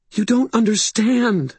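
high, frightened voice; distraught protest. A narrator's reading. Sarissa protesting to Justine, distraught. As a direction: plead high, close to panic.

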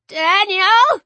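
put-on high, loud child's cry for help; little boy calling in alarm; Jack. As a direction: call out high and frightened. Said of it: "Jack said".

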